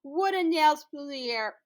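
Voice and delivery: usual speaking voice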